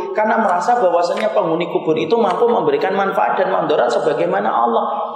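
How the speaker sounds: high, loud, fast-driving sermon voice; full-force preaching. Acted. Preacher warning the congregation about shirk, loud and fervent.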